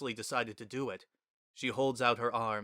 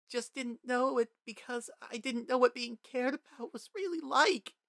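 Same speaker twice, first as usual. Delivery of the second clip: much higher, halting tearful confession; sobbing child; 14 semitones higher than usual. A narrator's reading. Frisk confessing to Toriel through sobs, high broken crying. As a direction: choke it out between sobs. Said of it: "in between your ugly sobs".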